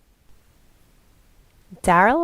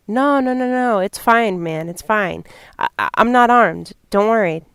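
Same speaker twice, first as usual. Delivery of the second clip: pleading; 4 semitones higher, 7 dB louder than usual. Acted. Suspect pleading with nervous homeowner.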